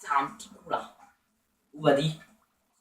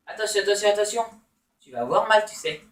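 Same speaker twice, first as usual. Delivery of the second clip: normal talking voice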